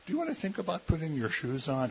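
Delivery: soft, quick negotiating parent asking; coaxing parent. Retold or imitated. Parent sweetly negotiating with a child over shoes. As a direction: gently coax the kid with a question. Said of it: "modern parents negotiate with their kids".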